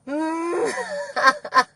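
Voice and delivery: slow, tearful sobbing pleas; crying and begging between sobs. Real, not acted. Sobbing in police car.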